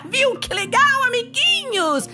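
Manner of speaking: high, loud cheerful laugh; excited laugh. Real, not acted. Host laughs, cheers kids. (riso animado e alto)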